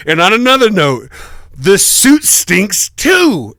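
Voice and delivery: loud, high, slow mock indignation; retold dad's complaint. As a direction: imitate dad piling on a grievance, drawn out. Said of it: "And he goes".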